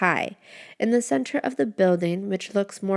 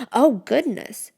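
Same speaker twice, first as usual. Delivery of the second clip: higher, louder, slow gasp of surprise; startled exclamation; 5 semitones higher, 6 dB louder than usual. A narrator's reading. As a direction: blurt it out, then catch yourself. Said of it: "it lets out".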